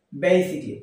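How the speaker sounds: "basically" said as "BAY-sik-li", three syllables; correct pronunciation. Shown as a demonstration. Teacher giving learners the proper form, "basically" as BAY-sik-li. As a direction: say "basically" with long "bay", dropping the "al". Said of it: "What is it?".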